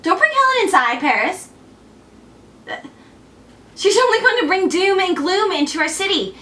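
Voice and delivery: high, urgent prophetic plea; urgent warning. Acted. Cassandra warning Paris about Helen, urgent plea. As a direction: plead as a doomed prophetess.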